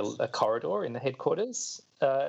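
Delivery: ordinary delivery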